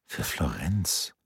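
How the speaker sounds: leise, langsam, fragend steigend auf "Florenz"; "Für Florenz?" leise und langsam, zweifelnd angehoben; ungläubiges Nachfragen. Acted. Leonardo wiederholt zweifelnd. (leises, langsames ungläubiges Nachfragen)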